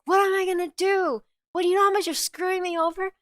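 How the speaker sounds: high, fast, whiny put-on boss voice; upset boss. Boss complains he is being screwed over, high and frantic. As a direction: pitch it way up and rush the indignant protest. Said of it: "the boss got so upset".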